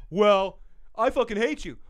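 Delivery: lightly hammed-up angry retort; mock arguing. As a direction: throw it back like a bickering couple. Acting both sides of a quarrel.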